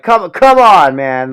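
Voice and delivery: high, loud incredulous come on; hammed-up exasperation; exasperated mock protest. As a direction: cry out in disbelief at the show. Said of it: "It's like".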